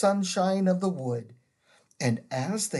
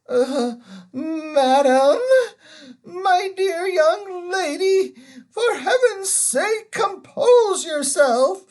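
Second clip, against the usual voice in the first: put-on high, loud, tearful old man pleading; panicky, quavering plea to the witch; trembling scream; 13 semitones higher, 8 dB louder than usual. A narrator's reading. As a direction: Uncle Andrew begging the witch to calm down, tearful. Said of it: "in a sort of trembling scream".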